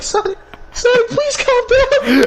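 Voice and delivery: high, pleading falsetto; Tails. Acted. Tails begging his friend to calm down, high-pitched pleading. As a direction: squeal it high, slowing into the plea. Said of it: "Tails like".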